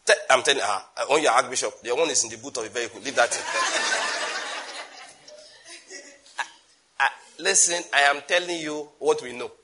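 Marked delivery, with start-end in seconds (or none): 3.5-5.0 high airy burst of laughter; breathless laugh. Real, not acted. Laughing at the bishop story.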